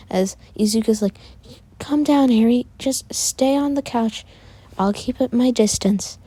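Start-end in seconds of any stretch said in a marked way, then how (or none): 1.8-6.2 high voice, easing down gradually; calming sister. Acted. Izuka tells Eri to stay put, high, urging calm. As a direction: urge calm in a raised girl's voice.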